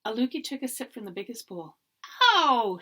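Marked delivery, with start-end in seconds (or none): 2.4-2.8 sharp loud yelp of pain. A narrator's reading. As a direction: cry out as if the soup burned. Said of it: "she cried".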